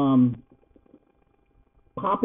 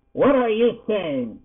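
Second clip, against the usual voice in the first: higher-pitched demand, falling at the end; 7 semitones higher than usual. A narrator's reading. Yarif turning to Poppy, demanding. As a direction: demand an answer. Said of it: "he demanded".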